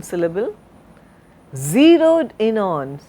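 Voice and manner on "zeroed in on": "zeroed" drawn out and leaned on, "in on" falling away; stress on ZEROED. Shown as a demonstration. Teacher demonstrating correct stress to students, stress on "zeroed". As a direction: hit the first sound of "zeroed", let "in on" drop. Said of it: "I stressed on the initial sound".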